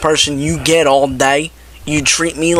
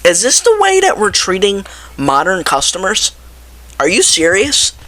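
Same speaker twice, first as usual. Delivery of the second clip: higher, indignant retold rant; retold angry outburst; 6 semitones higher than usual. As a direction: fume at the cashier in disbelief. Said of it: "I got so pissed off".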